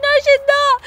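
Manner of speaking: very high, loud outburst; retold exasperated shout; frustrated protest. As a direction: snap back in disbelief. Denying the baby is close.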